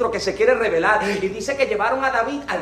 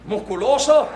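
normal talking voice

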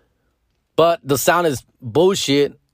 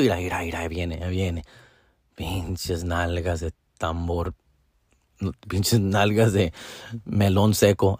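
low, much softer muttered jab; quietly mocking the ex as she comes out the door; under the breath; 8 semitones lower, 6 dB quieter than usual. Pulling up to the ex's house, low and much softer under the breath. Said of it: "say a little something under our breath".

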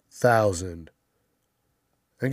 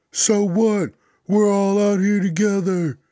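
much higher, loud put-on voice, brushing it off; defiant retort; 10 semitones higher, 7 dB louder than usual. Unvaccinated guy brushing off his accuser, high and loud. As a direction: toss it back, mocking and careless. Acted defiant reply in quarrel.

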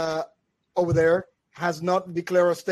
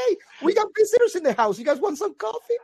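much higher, fast, chirpy hostess; lightly voiced cheerful old mom offering coffee; cheerful mother; 13 semitones higher, 3 dB louder, faster than usual.

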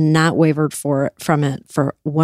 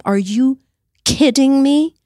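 exasperated disbelief; 8 semitones higher than usual. Real, not acted. Furious rant about administration officials.

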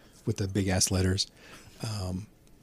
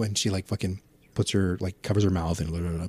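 ordinary talk, nothing marked

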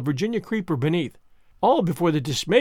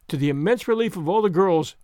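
ordinary delivery

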